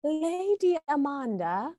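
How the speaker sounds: high, slow, soft mock-British title; drawn-out faux-British grandeur; pretend British accent. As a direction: announce it in a put-on British accent. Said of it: "I have this British accent".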